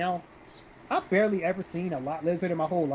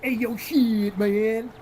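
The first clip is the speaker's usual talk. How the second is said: mocking put-on voice, louder and drawn out; Tyrone; 3 semitones higher, 4 dB louder, much slower than usual. Shown as a demonstration. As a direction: exaggerate a street-guy caricature. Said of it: "sound like fucking Tyrone".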